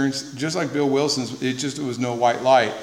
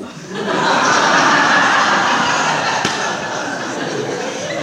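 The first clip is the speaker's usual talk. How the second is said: long laugh; 3 dB louder than usual. Real, not acted. Audience-facing long laugh.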